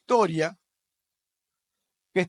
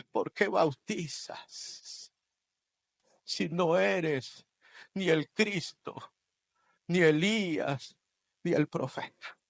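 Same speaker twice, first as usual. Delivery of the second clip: soft, slow, drawn-out questioning; slow hushed question; 6 dB quieter, slower than usual. Acted. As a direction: lean in and ask it gravely. Pharisees' question slowed and hushed. (pregunta lenta y en voz baja)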